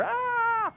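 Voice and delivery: put-on a short high yelp of fleeing kids; mock scream. Kid yelping while chased by mom. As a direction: squeal like a kid running from the swatter.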